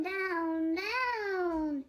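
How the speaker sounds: ordinary delivery, nothing marked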